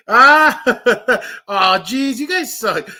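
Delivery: loud laughing yelp; laughing outburst. Real, not acted. Caught by the chat, loud laughing outburst. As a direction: burst out laughing. Reacting to viewers' prank.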